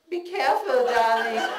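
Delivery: high, loud call down a hallway; yelled warning. Retold or imitated. Mother yelling down the hallway to her child, loud and high. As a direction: shout it after her as she goes. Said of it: "She yelled down the hallway".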